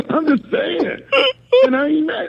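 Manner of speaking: high, crying with laughter; laughing to tears. Real, not acted. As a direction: wheeze it out through laughter.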